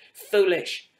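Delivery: ordinary delivery, nothing marked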